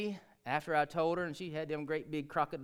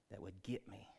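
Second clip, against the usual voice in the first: whisper; 6 semitones lower, 12 dB quieter than usual. Acted. Hushed admission of deserved judgment.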